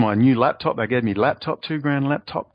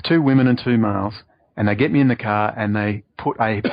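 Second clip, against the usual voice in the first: normal talking voice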